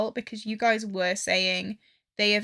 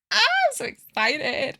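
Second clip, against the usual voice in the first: high squeal of real excitement, higher and louder; 11 semitones higher, 4 dB louder than usual. Real, not acted. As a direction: burst out thrilled.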